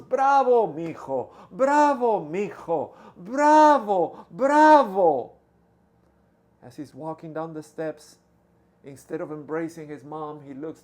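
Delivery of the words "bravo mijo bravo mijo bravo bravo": high, loud cheering from the crowd; proud yelling. Acted. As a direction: shout it out across the hall to her son. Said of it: "yelling at the top of her lungs".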